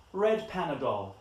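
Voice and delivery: ordinary delivery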